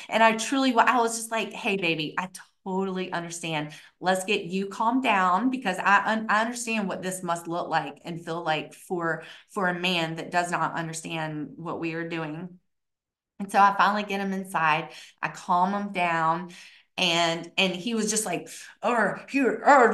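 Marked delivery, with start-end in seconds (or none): 1.5-12.6 softer, lower reassurance; retold soothing, keep it unruffled; cool, unfazed. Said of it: "my coolness". 18.8-19.9 imitating flustered sputter; mock flustered man. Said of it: "just so flustered".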